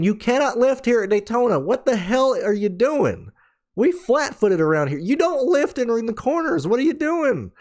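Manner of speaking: high, exasperated mock yelling; mock angry spotter. Spotter ranting at the driver over the radio, mock fury. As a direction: play the spotter ranting, half-hearted. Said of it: "the spotter just went off on her".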